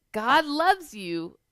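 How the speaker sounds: plain reading voice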